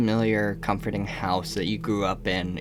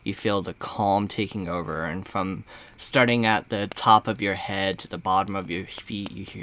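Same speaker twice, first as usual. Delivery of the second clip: guided meditation; 3 semitones lower than usual. Acted. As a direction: ease the player into a trance, unhurried.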